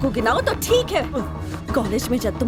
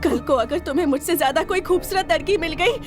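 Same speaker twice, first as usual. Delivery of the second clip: anguished tirade; 3 semitones higher than usual. Acted. Wife refuses life with murderous husband, anguished and furious. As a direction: build the outrage toward a cried-out finish.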